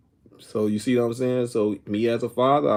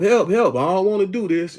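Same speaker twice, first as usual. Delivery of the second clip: higher-pitched mock cry for help; mock hollering; 7 semitones higher, 3 dB louder than usual. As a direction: holler it lightly, like someone begging off. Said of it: "that person can be screaming and hollering".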